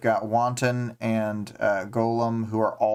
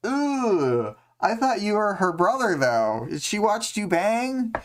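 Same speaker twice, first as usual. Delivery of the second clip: mock shocked; 9 semitones higher than usual. Host voicing Clock Blocker, scandalised and pitched up high. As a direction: act scandalised by the gossip, pitched way up. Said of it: "Clock Blocker is like".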